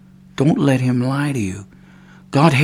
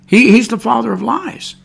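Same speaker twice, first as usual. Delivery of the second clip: fervent preaching; 6 semitones higher, 5 dB louder than usual. Real, not acted. Preaching at full force.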